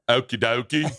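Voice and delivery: a light Aussie okie dokie; mock Australian. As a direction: try the accent again, cheerfully. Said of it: "That's not it".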